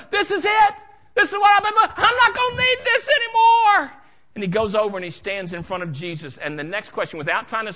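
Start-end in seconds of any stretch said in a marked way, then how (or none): 0.1-3.9 very high, loud outburst; exultant cry. Acted. Beggar throws away his coat as he knows, exultant shouting. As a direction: burst out at the top of your voice.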